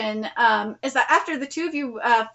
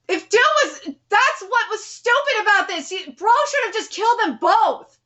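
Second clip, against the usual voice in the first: frustrated rant; 6 semitones higher than usual. Real, not acted. Reader rants at the characters.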